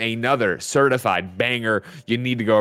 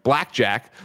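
ordinary talk, nothing marked

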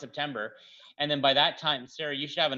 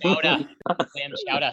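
"Chowder" and "Clam chowder" belted louder, Boston style, no final r; loud Boston chowdah; 4 dB louder, slower than usual. Pretend. Host showing the cohosts how Bostonians say it, in a loud Boston accent. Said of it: "Say it with me".

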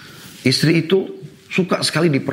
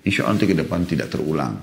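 gentle apology; 6 semitones lower than usual. Shown as a demonstration. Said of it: "Harus duduk dulu". (permintaan maaf yang lembut dan rendah hati)

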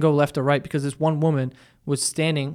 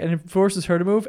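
ordinary delivery

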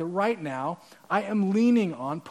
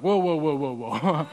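backing off; 4 semitones lower than usual. Acted. Crowd voiced backing away.

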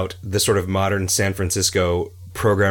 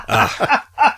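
squealing laugh, pitched way up; high burst of laughter; 5 dB louder than usual. Real, not acted. Laughing at own joke.

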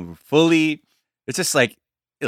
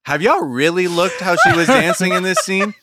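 laughing mockery; 8 semitones higher, 5 dB louder than usual. Quoting viral tweet, laughing.

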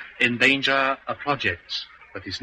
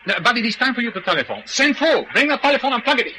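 high, fast, raised orders to a servant; commanding orders; 11 semitones higher, faster than usual. Acted. Barking orders at his servant.

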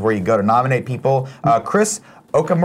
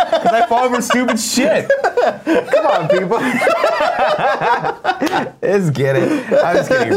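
laughing; 13 semitones higher than usual. Real, not acted. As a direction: laugh through the words. Laughing hard at himself.

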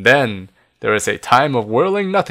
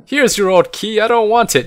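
high, raised-voice outburst; defiant cry; 10 semitones higher, 4 dB louder than usual. A narrator's reading. Child throwing the key at the wizards, crying out. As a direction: cry it out, louder and higher. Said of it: "you cry".

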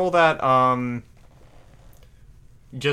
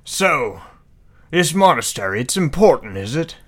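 put-on mirrored posh accent; 4 semitones higher, 7 dB louder than usual. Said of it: "Double mirrored accent there".

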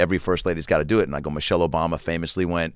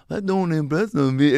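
high, slow mimicry of a catchphrase; 7 semitones higher, slower than usual. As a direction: stretch it out in a high imitated star voice. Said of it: "does that back to me".

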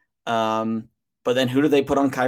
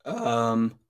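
voice crack; 3 dB quieter than usual. Real, not acted. Podcast guest starting to answer the host, voice cracking. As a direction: let the voice crack on the filler. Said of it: "excuse the voice crack".

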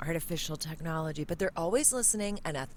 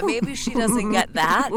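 ordinary talk

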